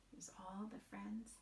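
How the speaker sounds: soft whispered aside. Real, not acted. Teacher whispering to her class, softly.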